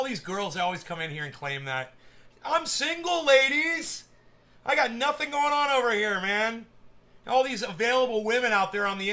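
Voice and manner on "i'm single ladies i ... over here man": high, loud mock plea to the viewers; playful pleading call. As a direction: call out to the ladies, pitched up. Calls out to women watching.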